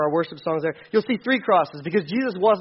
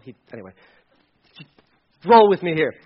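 usual speaking voice

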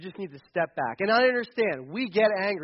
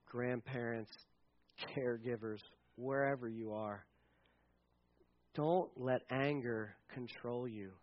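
quiet earnest appeal; 8 semitones lower, 11 dB quieter than usual. Pretend. Paraphrasing Paul's appeal to parents.

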